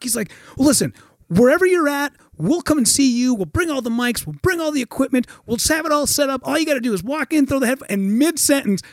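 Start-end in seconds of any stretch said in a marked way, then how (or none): 0.6-7.9 high, rushing sales pitch; acted desperate persuasion; eager pleading. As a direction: plead without stopping for breath.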